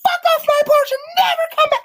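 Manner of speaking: high, loud screeching yell; shrieked parental outburst; ballistic mom. Retold or imitated. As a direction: scream it as a furious mother at the top of your range. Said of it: "she went ballistic".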